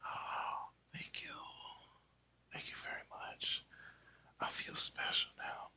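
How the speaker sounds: breathy hushed thanks; whisper. Retold or imitated. Imagined artist thanking a client, whispered. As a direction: whisper it like a private moment. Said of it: "Why are you whispering?".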